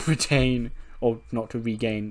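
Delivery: ordinary talk, nothing marked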